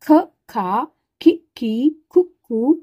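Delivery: ख, खा, खी, खी, खु, खू recited one by one, each vowel clear, the long ones held longer; reciting the matras. Shown as a demonstration. Teacher reciting the syllables to her students, clearly and deliberately. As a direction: sound out each syllable for the class to copy. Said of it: "तो इसको उच्चारण करेंगे".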